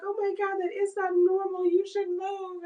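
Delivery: high-pitched imitation of a freaked-out friend; mock alarm. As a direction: quote her fretting, high and hurried. Quotes alarmed friend on FaceTime.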